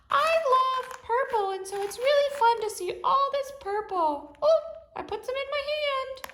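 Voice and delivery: high, bouncy little toy voice, full of glee; playful toy character. Acted. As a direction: play a cheerful little toy who just rode in. Host voices a motorcycle-riding toy character.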